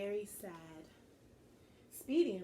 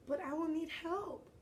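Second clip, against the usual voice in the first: put-on higher pleading boy voice; 8 semitones higher, 4 dB louder than usual. Lucas begging the queen for help, pleading. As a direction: beg for help, a little high. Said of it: "Lucas pleaded".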